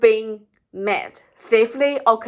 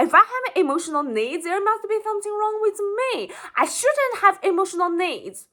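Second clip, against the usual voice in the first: put-on ashamed child; 7 semitones higher, faster than usual. Voices ashamed child's belief.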